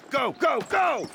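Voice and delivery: high yelled go go go; shouted orders. Acted. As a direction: drive the recruit forward, yelling. Drill instructor urging recruit on.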